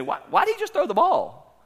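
put-on high, wondering kid's voice; puzzled child. Preacher imitating his kids asking about the ball. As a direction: ask it like a confused little kid. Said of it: "and they'd say".

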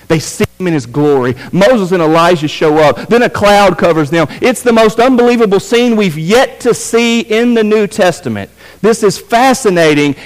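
high, driving pulpit retelling; excited preaching. Real, not acted. Preacher retelling the unbelievable mountain scene, excited.

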